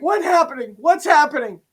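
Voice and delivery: high, imitated panicky questioning; mock-frightened cries of confusion; alarmed. Imitating the friend as Freddy grabs her, alarmed.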